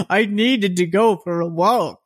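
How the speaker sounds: high, drawn-out mock plea; tearful begging woman. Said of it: "kept crying about her child".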